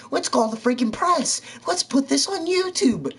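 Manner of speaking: very high, loud squealing gossip; gossiping schoolgirls. Retold or imitated. Schoolgirls hyping the breakup drama, squealing mock gossip. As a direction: ham up the shocked girls building hysteria. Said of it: "And they're like".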